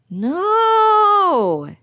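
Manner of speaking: long, high yelled no; a drawn-out shout, high and loud. A narrator's reading. Driver to the passenger as the car goes over the cliff, yelled. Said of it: "he yells".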